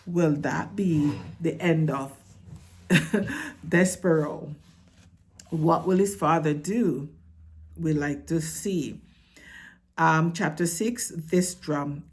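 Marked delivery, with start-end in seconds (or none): none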